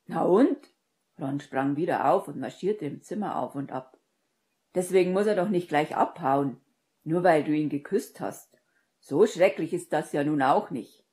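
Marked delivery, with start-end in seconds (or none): 0.1-0.6 loud, challenging comeback; agitated, punchy challenge; indignant retort. A narrator's reading. 4.7-11.0 loud, exasperated arguing; worked-up friend, raised volume; agitated protest. A narrator's reading. Agitated friend argues loudly.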